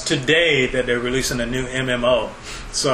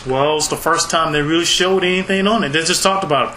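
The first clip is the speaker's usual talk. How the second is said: ordinary delivery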